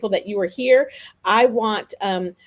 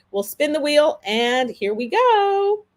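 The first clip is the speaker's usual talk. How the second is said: higher, drawn-out build to the spin; hyped giveaway announcement; 6 semitones higher, 3 dB louder, slower than usual. Real, not acted. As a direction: announce the spin like a game show. Spinning the giveaway wheel.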